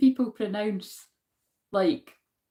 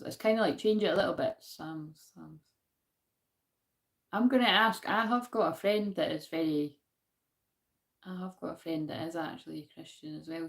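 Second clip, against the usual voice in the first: ordinary delivery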